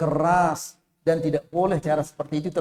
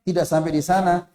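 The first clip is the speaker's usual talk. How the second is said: usual speaking voice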